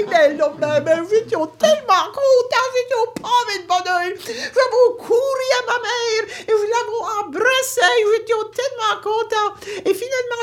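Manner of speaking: high, laughing and crying with joy; tearful elation; overjoyed. Retold or imitated. (joie aux larmes)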